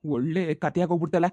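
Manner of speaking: ordinary delivery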